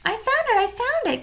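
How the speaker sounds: high, excited exclamation; cried. A narrator's reading. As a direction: burst out with the discovery. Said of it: "cried Jack".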